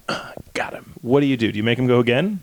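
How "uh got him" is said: whispered, trailing-off admission; embarrassed whisper; sheepish whisper. Retold or imitated. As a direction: confess it under your breath. Guy admits he only slapped.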